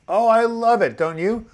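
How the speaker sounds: high, loud burst of glee; squealing with delight at the pun; manic delight. Real, not acted. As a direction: gush over your own joke. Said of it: "didn't mean to be manic here".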